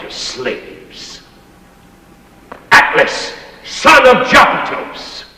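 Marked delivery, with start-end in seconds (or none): 2.7-5.2 loud, high mock-heroic announcement; ringing showman's call; grand proclamation. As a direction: proclaim it like a herald. Grand mock introduction of Lucky.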